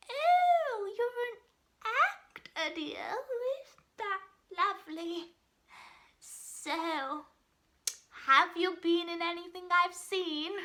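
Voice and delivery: high, loud opening, drawn out on "actor"; piping auntie. Acted. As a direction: put on a nosy aunt piping up at dinner. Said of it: "she pipes up".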